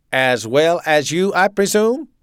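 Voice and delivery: loud, high German officer; authoritarian, punched-out German accent; staccato German accent. A narrator's reading. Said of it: "he spoke with a staccato German accent".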